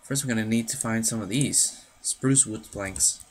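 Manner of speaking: normal talking voice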